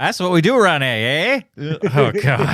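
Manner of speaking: put-on comic, overdone Aussie accent; mock Australian accent. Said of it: "Monty Python doing an Australian accent".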